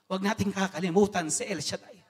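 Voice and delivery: normal talking voice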